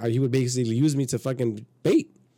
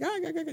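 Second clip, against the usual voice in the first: repeated high "ah" in imitation; mock gushing noises; 5 dB quieter, much faster than usual. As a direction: imitate them reacting in high little ahs. Imitates the women's reaction.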